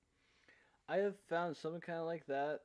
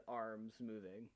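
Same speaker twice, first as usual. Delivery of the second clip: normal talking voice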